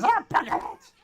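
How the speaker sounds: high, loud goblin outburst; frustrated goblin shriek; annoyed goblin. Acted. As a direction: yell it like a thwarted little goblin. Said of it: "And the goblin says".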